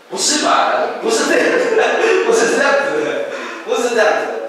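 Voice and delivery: 笑着连声否认，音调高很多; 带着笑意高声澄清刚才是开玩笑; 笑着否认. Real, not acted. Joking denial after a mock bargain prayer. (边笑边高声连连否认)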